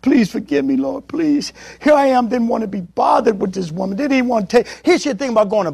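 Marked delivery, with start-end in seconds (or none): 0.0-1.5 weeping prayer. Retold or imitated. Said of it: "I begin to weep".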